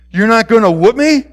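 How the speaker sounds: loud, high incredulous question; puzzled disbelief. A narrator's reading. Boy expected a whipping from the principal, incredulous. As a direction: pitch it up as an astonished boy.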